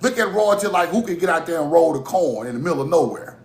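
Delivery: normal talking voice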